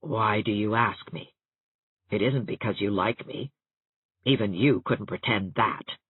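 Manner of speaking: low, soft, suspicious voice; slow and wary. A narrator's reading. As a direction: draw each line out, distrusting her. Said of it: "Catherine said slowly".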